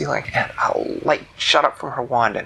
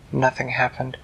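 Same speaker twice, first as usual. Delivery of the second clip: usual speaking voice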